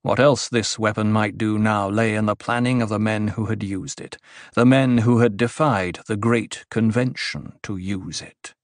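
ordinary delivery

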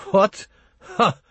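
high, startled outburst; a sharp high "What?" and a scornful "Ha!"; incredulous scoff. Acted. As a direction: scoff in high-pitched disbelief. Startled scoff at the monitor's baggage.

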